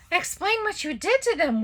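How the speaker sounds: high, loud angry demand. Acted. Waffle demanding the professor explain what she did. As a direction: demand answers furiously. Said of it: "Waffle demanded".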